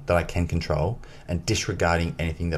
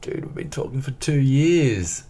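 exasperated; 7 semitones higher than usual. Retold or imitated. Retold exasperation at swim chief.

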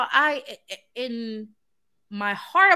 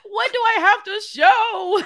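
dismayed; 8 semitones higher, 7 dB louder, slower than usual. Retold or imitated. Voicing panic at turning thirty.

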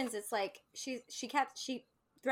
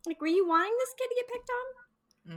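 incredulous mock question; 14 semitones higher, 4 dB louder than usual. Mocking the kid's parents.

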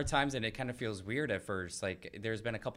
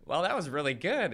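put-on higher, louder light country accent; lightly put-on country accent, pleased; 8 semitones higher, 7 dB louder than usual. Quoting the caller's reaction, a light country accent. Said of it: "he has kind of a country accent".

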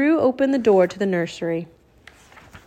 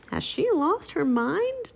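slow, high, hushed wondering; baffled, drawn-out private question; 8 semitones higher, 5 dB quieter, slower than usual. A narrator's reading. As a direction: puzzle over it softly to yourself. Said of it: "Jack wondered".

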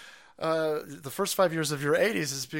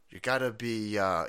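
usual speaking voice

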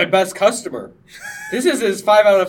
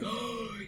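mock gasp; 6 semitones higher, 15 dB quieter than usual. Ralph's reaction retold.

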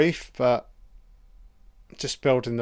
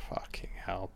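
a voiceless, much softer "Fucking hell"; whisper; 4 semitones lower, 14 dB quieter than usual. Real, not acted. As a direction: breathe out "Fucking hell" in a hush. Whispered aside while watching film.

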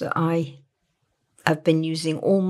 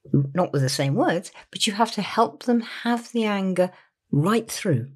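normal talking voice

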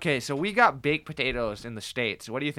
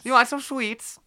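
put-on British accent; 10 semitones higher, slower than usual. Mock British offer of candy.

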